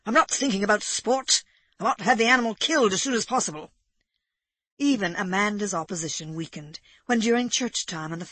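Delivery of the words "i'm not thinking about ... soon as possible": fast, impatient gentleman; a touch of an exasperated squire; Egbert irritated. A narrator's reading. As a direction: rattle it off in exasperation. Narrator voices irritated Egbert.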